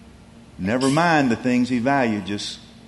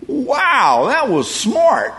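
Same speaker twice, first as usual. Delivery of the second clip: high, louder, slow impressed exclaim; amazed at the clever trick; impressed admiration; 11 semitones higher, 5 dB louder, much slower than usual. Retold or imitated. Master marveling at his steward's scheme, high and loud.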